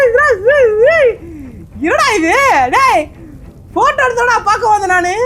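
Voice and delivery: high, loud frightened shouts; scared yelling. Real, not acted. Gamer jumps at a ghost and yells in fright. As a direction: yell out in real fright.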